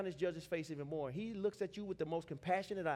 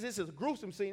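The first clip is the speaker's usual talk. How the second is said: ordinary talk, nothing marked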